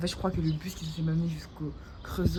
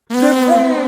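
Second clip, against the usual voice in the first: emphatic shout; 7 semitones higher, 18 dB louder than usual. Real, not acted. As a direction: shout "De fond!" high and loud.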